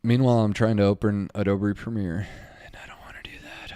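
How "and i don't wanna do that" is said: fast whispered grumble; hushed reluctant aside. Real, not acted. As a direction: mutter it under your breath quickly.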